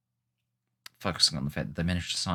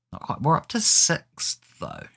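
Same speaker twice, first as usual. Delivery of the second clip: excited; 9 semitones higher, 5 dB louder than usual. Real, not acted. Excited about climbing the table.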